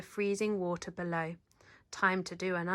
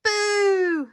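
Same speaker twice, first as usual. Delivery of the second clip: loud high sudden boo; spring out and yell boo; scary boo; 11 semitones higher, 10 dB louder than usual. Acted. Cub's final scare on man.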